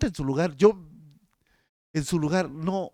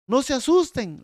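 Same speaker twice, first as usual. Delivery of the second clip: angel's call; 9 semitones higher, 7 dB louder than usual. Acted. Angel calming frightened women.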